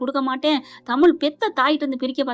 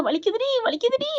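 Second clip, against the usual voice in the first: crying out in pain; 9 semitones higher than usual. A narrator's reading. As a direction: wail that it hurts, play-acted. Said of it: "அப்படின்னு கத்துறாங்க".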